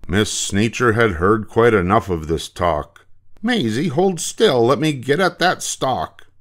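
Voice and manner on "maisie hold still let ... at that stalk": high-pitched, fed-up teacher's order; impatient teacher. Acted. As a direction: order the child about, voice raised in pitch.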